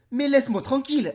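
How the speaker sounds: loud, high fed-up outburst; mock exasperation. Youtuber scolding his blocked nose, loud mock outburst. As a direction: snap at your own nose.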